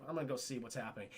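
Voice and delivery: retold hushed line; hushed and quick. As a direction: say it under your breath, hurrying. Creeping toward danger, hushed.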